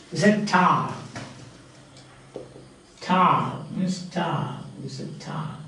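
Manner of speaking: "Car" ending on an open long vowel; silent final R. Shown as a demonstration. Teacher showing R is not realized at word end, silent R. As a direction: leave the R off "Car". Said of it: "never pronounced at the end of".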